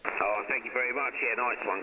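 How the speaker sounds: ordinary talk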